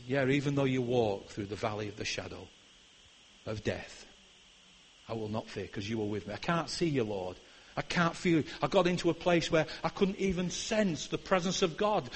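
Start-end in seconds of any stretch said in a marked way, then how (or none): 0.1-8.4 very soft, low recitation of the psalm; hushed psalm. Retold or imitated. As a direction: breathe the words in the dark valley. Quoting psalm in lonely valley.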